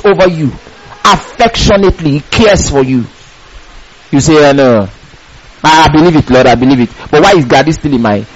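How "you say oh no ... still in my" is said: low, mild plaintive believer's voice; lightly voiced doubter, pitched low; doubting believer. A believer answers God with doubts, mildly troubled.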